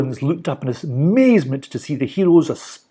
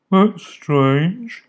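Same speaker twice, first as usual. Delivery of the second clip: frowning; 6 semitones higher than usual. A narrator's reading. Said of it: "he frowned".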